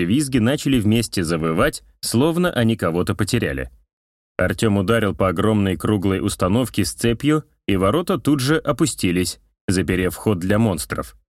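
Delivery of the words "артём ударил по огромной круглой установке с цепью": ordinary delivery, nothing marked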